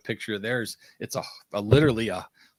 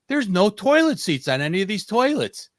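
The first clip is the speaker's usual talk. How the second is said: high, loud imitation of a shocked complaint; mock dismay; 10 semitones higher, 5 dB louder than usual. Wife back from an Italian bathroom reporting to husband, mock dismay. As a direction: complain in disbelief, voice pitched up. Retelling his wife's complaint in Italy.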